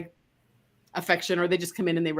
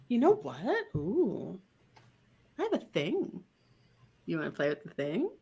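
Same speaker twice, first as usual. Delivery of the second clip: soft teasing invitation; 8 dB quieter than usual. Shown as a demonstration. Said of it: "you'll go like".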